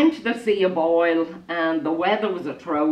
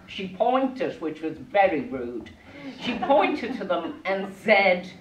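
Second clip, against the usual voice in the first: plain reading voice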